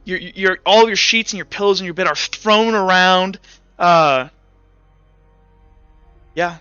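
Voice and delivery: ordinary delivery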